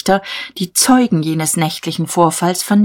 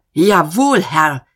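thundering; 3 semitones higher, 3 dB louder, slower than usual. A narrator's reading. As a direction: thunder it at him. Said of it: "Der Major donnerte".

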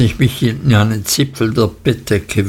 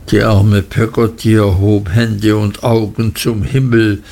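ordinary delivery

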